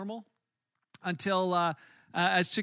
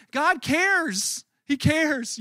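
emphatic; 7 semitones higher, 7 dB louder, much slower than usual. Real, not acted. Emphatic preaching climax.

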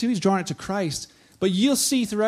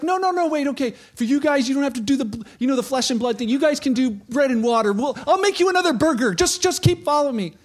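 higher, louder, hurried mock plea; mock pleading Jesus; 6 semitones higher, 5 dB louder than usual. Preacher voicing Jesus begging departing followers, higher and louder. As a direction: play Jesus chasing after them, begging.

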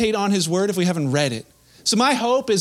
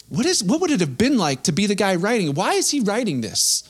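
usual speaking voice

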